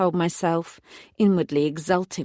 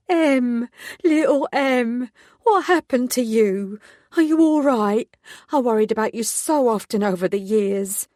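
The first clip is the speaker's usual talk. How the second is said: Julie; 5 semitones higher, 4 dB louder than usual. A narrator's reading.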